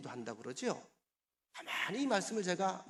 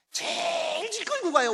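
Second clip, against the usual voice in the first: high, loud, stretched first word; slow, exaggerated high drawn-out stretch; dragged-out emphasis; 12 semitones higher, 7 dB louder, slower than usual. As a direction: drag the word out like dragging feet. Mimics reluctant dragging walk.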